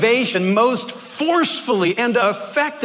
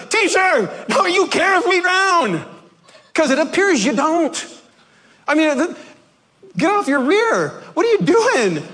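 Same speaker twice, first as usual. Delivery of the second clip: yelling at Jesus; 10 semitones higher than usual. Retold or imitated. Disciples yelling at sleeping Jesus in the storm. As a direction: yell at him to get up. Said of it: "picture them yelling at Jesus".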